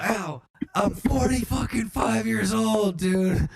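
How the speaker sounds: high-pitched mock tirade; comic outraged rant; indignant outburst. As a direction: protest indignantly, pitched well up. Acting an indignant Frankie Muniz.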